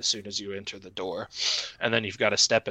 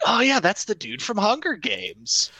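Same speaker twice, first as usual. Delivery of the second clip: high, drawn-out know-it-all outburst; mock confident exclamation; 12 semitones higher, 4 dB louder than usual. Player retelling a blunder to friends, confident exclamation. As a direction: blurt it out high, sure of yourself.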